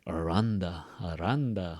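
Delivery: "Aranda" said as one word, stress on middle "ran"; blended "Aranda". Shown as a demonstration. Teacher demonstrating linking, "Aranda" as one word. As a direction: run "are on the" together as "Aranda". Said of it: "sounds like a different word".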